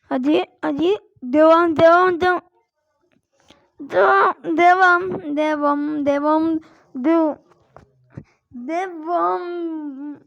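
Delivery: loud, urgent cheering; excited shouting. Real, not acted. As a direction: push yourself on, shouting. Cheering himself on in game.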